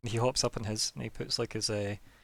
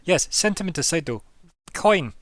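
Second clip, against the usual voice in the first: pressing gnome; 7 semitones higher, 5 dB louder than usual. Acted.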